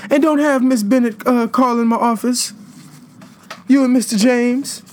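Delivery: high, breathy school disciplinarian scolding students; breathy disciplinarian impression. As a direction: play the out-of-breath school disciplinarian calling kids out. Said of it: "that used to talk like that".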